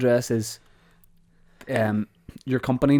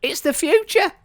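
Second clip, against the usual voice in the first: high grand pronouncement. Pretend. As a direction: jump way up into a grand voice. Grand pitch punchline.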